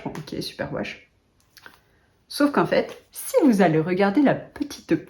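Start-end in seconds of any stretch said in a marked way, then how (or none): 0.0-1.1 sceptical mutter. Real, not acted.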